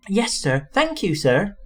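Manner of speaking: a grateful little engine, bright and eager; happy. A narrator's reading. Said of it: "said Percy happily".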